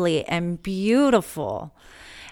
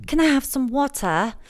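higher-pitched imitation English accent; daughter's English accent, pitched up; 6 semitones higher than usual. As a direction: mimic a child's English accent. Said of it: "talking like she was, like, from England".

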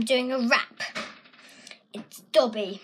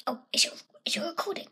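whisper; 6 semitones higher, 6 dB quieter, much faster than usual. Real, not acted. Checking if recording.

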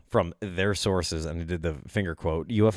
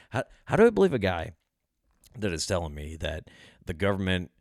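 normal talking voice

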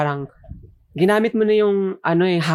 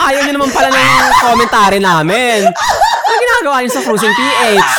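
loud teasing through laughter; laughing mock scold; 6 semitones higher, 6 dB louder than usual. Podcast host teasing listeners, laughing loudly. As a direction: scold the listeners while cracking up.

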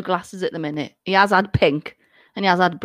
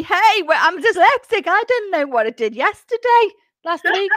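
1 mock scatterbrain; 8 semitones higher than usual. Jokes about dyslexic forgetfulness.

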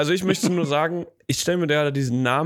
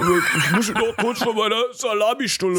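mouth full; 8 semitones higher than usual. Pretend. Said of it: "Den Mund voll gehabt".

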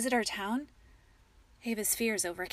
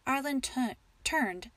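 usual speaking voice

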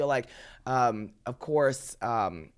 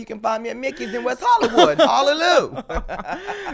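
high, loud, excited plug; hype announcement; 9 semitones higher, 8 dB louder than usual. Real, not acted. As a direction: sell the show like a preacher. Hyping her finale appearance.

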